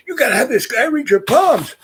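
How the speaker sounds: high, eager voice pressing the men; excited urging. Retold or imitated. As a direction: gush like she's just seen a miracle. Lady urging the officials.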